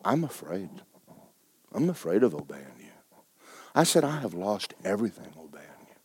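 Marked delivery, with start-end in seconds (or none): 0.0-2.9 hushed, low retelling of being afraid; soft and subdued, admitting fear; fearful confession. Retold or imitated. Retelling his tearful confession to the Lord, afraid. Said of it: "I, I wept. I said". 4.0-5.9 near-whisper, very soft; hushed, as if confessing through remembered tears; broken confession. Retold or imitated. Telling the Lord what obedience cost, hushed. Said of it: "I, I wept. I said".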